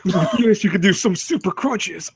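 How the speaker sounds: slow, hyped-up product pitch; hammed-up advert voice; mock infomercial announcer. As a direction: sell it like a TV exercise gadget. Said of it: "sounds like some kind of exercise device".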